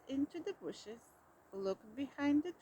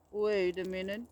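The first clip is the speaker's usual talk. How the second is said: a lower, louder growl of warning; 5 semitones lower, 6 dB louder than usual. A narrator's reading. Bear stopping Mouse, low warning growl. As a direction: growl it out, suspicious. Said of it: "growled Bear".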